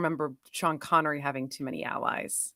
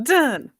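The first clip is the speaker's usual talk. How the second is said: high, loud sung-out orchestra stab; imitated orchestral hit; 8 semitones higher, 7 dB louder than usual. Mimicking the score as Bond beats the spider, dramatic stab. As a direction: punch it like a film-score sting. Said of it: "there were orchestral beats that hit".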